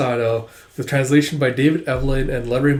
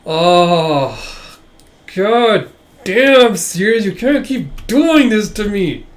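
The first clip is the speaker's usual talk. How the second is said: loud, high, drawn-out fan outburst; exasperated swooning; 7 semitones higher, 6 dB louder, much slower than usual. Real, not acted. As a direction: wail it, overwhelmed by the series. Fan reacts to attractive character.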